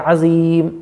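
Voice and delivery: अज़ीम slow with a buzzing ज़; clear Azeem. Shown as a demonstration. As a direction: stress the ज़ of अज़ीम. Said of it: "अजीम नहीं है". (अज़ीम 'ज़' के साथ धीरे)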